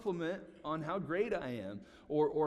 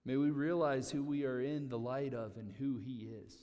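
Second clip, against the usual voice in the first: usual speaking voice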